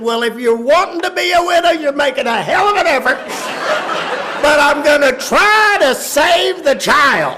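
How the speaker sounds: high yell, fast then emphatic; exasperated father. Retold or imitated. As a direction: shout down the wife mid-quarrel. Father retorts in quarrel.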